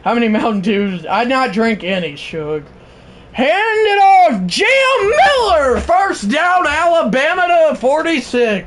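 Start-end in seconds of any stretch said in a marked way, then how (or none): 3.4-8.7 live play-by-play. Acted. Streamer calling the Alabama drive to chat, excited. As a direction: build the call to a yell as the pass sails incomplete.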